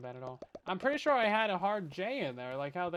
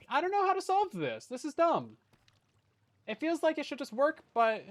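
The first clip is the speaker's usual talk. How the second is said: frustrated; 9 semitones higher, 4 dB louder than usual. Real, not acted. Stuck on a broken contraption.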